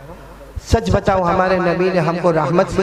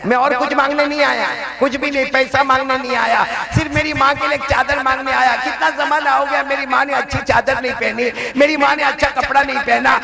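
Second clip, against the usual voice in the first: put-on higher, gentle pleading child; lightly voiced pleading boy; 7 semitones higher than usual. Preacher voicing the orphan boy begging his uncle, a touch high and tender. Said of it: "कितने प्यार से कहता है".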